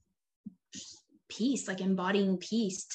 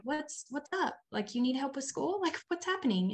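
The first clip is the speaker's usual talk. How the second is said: higher, softer, caring questions; gentle check-in; 6 semitones higher, 4 dB quieter than usual. Retold or imitated. Mother checking on frustrated daughter, soft and higher. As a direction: coax her kindly, lightly lifted.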